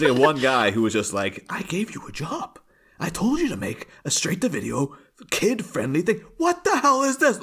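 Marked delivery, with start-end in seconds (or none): 1.5-7.4 tag. Retold or imitated. Irate executive acted out, pitch raised.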